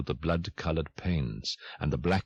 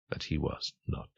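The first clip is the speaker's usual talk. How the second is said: hushed whisper; 2 semitones lower, 6 dB quieter than usual. A narrator's reading. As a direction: drop to a soft unvoiced whisper. Narrator echoes line, hushed.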